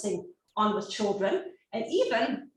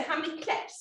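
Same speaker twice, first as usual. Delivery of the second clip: put-on teacher-to-toddler question; 6 semitones higher, 5 dB quieter than usual. Shown as a demonstration. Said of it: "with younger children, we say".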